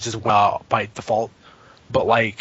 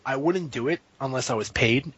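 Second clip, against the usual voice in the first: ordinary delivery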